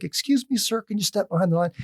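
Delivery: put-on high, polite little old lady; sweet old woman. Little old woman asking a customer to step back, sweetly. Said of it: "just the sweetest thing".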